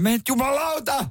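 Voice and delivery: high-pitched acted road-rage holler; mock shout from behind. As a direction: yell it at a timid driver. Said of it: "takaa huudetaan". (kimeä leikkihuuto takaa)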